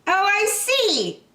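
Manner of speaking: high, slow gentle rabbit; understanding rabbit voice; kindly rabbit. Acted. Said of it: "said the rabbit kindly".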